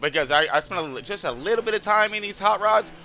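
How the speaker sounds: normal talking voice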